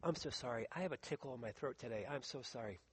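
low, very soft apology; apologetic aside. Real, not acted. Preacher apologizing to the congregation for a throat tickle, hushed aside. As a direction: drop right down and excuse yourself.

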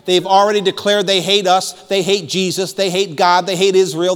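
ordinary delivery

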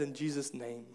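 hushed and low, almost spoken to oneself; a whispered offering of oneself; humble quiet prayer. Acted. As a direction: come before God softly, emptied out. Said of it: "We said".

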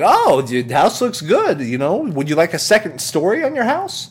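high, ingratiating salesman voice; Tom Nook. Acted. Salesman offering a second story, high sales patter. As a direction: pitch it up like an eager upseller. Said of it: "Tom Nook".

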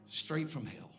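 ordinary delivery

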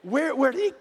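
loud, high astonished question; where did he get that; father stunned by his son's answer; bewildered. Retold or imitated. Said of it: "And I thought".

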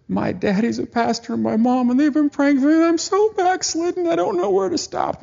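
high-pitched, rising tearful plea; imitating crying woman. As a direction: retell her sobbed confession, pitch climbing high. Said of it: "crying. And she goes".

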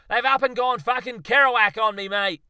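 very loud, high indignant shout; furious outburst. Acted. Darwin finds his monkeys gone, loud furious shout. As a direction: bellow it at the empty roof.

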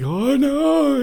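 high drawn-out wail of dread; mock dismay. Panicked website owners staying up all night, wailing in mock dismay. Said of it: "website owners who were like".

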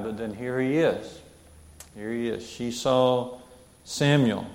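measured, earnest Bible reading aloud; steady public reading of scripture. Acted. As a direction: read the passage to the congregation.

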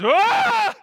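loud, high comic scream; hammed-up yell of terror; terrified scream. Government man seeing a bull charge, acted panicked scream.

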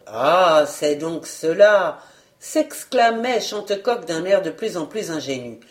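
ordinary delivery

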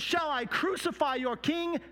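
high, challenging question; Pilate. Acted. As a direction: throw the question back at the mob. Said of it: "And Pilate said".

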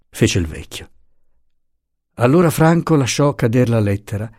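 usual speaking voice

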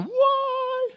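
very high, drawn-out mock wail; freaking out. Parent confronting child over dropped cake, freaking out. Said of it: "I'm kind of freaking out as a parent".

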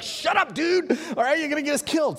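high, loud frantic scold, rushed at the end; sharp high telling-off from scared companions; hushed panic. Retold or imitated. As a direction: snap at him to shut up before he gets everyone killed. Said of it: "And everybody's like".

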